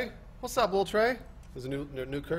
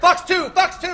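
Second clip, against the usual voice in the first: high, loud missile call; shouted pilot call; 11 semitones higher, 10 dB louder, slower than usual. Pretend. Mock pilot firing calls.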